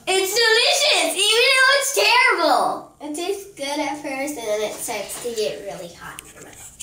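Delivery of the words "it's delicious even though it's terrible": excited reaction. Real, not acted. Reacting to hot mouthwash.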